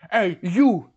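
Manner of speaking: loud, commanding hail; retold stern call-out. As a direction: call out the suspect sharply. Club president confronts the opponent.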